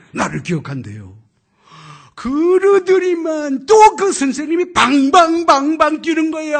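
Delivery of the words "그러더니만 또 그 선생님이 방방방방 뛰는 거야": high, loud, slow, with a cry in the voice; excited. Retold or imitated. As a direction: swept up in the teacher's joy. Excited retelling of teacher's joy. (흥분해서 높고 크게)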